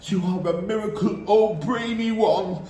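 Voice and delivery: high, excited cheering voices; crowd of witches cheering. A narrator's reading. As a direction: cheer the leader wildly. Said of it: "yelled the audience".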